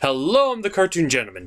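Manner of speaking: ordinary delivery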